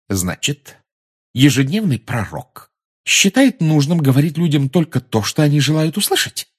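scathing, rising incredulous question; caustic. A narrator's reading. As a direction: challenge them with pointed sarcasm. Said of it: "язвительно спросила Гермиона".

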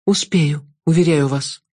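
a lightly voiced brave girl; young girl. The girl's voice.

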